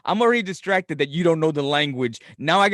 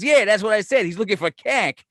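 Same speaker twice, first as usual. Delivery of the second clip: higher Boston clerk voice; Boston accent; 6 semitones higher, 3 dB louder than usual. Acted. Clerk insisting he said it right, Boston accent. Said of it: "got this real thick Boston accent".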